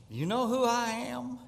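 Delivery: high, drawn-up 'do you know who I am'; haughty challenge. Retold or imitated. Lady challenging the usher.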